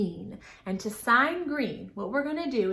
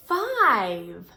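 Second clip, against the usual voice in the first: ordinary delivery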